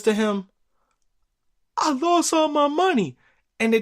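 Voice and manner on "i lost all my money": put-on upset kid. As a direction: blurt it like a boy in trouble. Kid confesses to dad.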